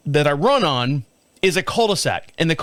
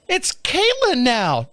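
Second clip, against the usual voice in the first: slow, higher-pitched correcting voice; prissy correction; 7 semitones higher, much slower than usual. Pretend. Vlogger voicing an imagined critic, slow and higher-pitched. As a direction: correct him slowly, primly.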